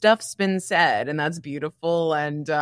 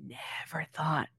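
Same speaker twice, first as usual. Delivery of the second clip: hushed whisper; 11 dB quieter, slower than usual. Real, not acted. Whispering about her dad listening to the podcast, hushed. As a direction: breathe it out like a secret.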